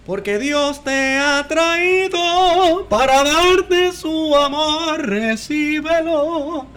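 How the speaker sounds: slow, high televangelist blessing; preacher voice. Pretend. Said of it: "¿Quieres un poquito de eco?".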